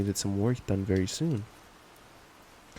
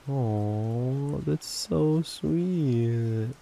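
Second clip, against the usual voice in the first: cooing aw; 3 semitones higher, much slower than usual. Real, not acted. Touched by story ending.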